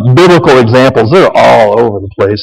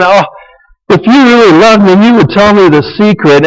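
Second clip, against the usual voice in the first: whiny Delilah; 7 semitones higher than usual. Retold or imitated. Delilah pressures Samson for his secret, whining high. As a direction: whine it like a nagging temptress. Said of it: "now she's whining again".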